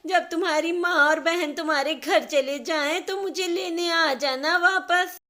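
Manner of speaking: high, loud whining rant breaking into sobs; tearful loud complaint. Acted. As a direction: pour out the grievance, crying, slowing at the end. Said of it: "जोर-जोर से रोने लगती है".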